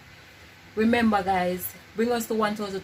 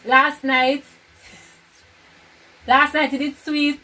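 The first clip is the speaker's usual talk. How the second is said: loud calls, raised pitch; 6 semitones higher, 9 dB louder than usual. Real, not acted. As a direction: call out over the music in your ears. Headphones on, shouting guesses.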